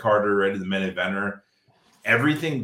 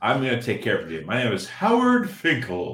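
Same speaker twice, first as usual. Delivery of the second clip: mock customer service as a ring announcer; Howard Finkel; 3 semitones higher than usual. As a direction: ring announcer building to the name. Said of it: "talk to you as Howard Finkel".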